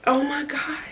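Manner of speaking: breathless whispered wonder; awed whisper. Real, not acted. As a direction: whisper it in awe. In awe of the jar.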